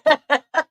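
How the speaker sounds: high, loud laugh; high laugh burst. Real, not acted. Laughing at how the aunt kept talking.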